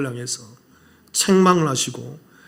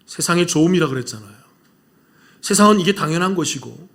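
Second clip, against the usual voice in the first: usual speaking voice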